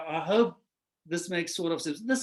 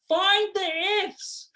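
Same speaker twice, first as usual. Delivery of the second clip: task given; 9 semitones higher, slower than usual. Retold or imitated. Lecturer sets the task.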